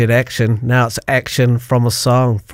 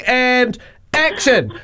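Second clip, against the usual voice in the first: drawn-out slow director's call of action; slow, higher playful action cue; mock director call; 8 semitones higher, slower than usual. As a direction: call action like on a film set. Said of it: "Thank goodness you said action".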